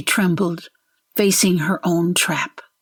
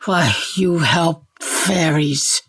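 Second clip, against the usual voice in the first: slow, slurred, drugged ogre; slower than usual. A narrator's reading. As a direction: drag the words out like a sedated ogre. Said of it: "His speech slurred".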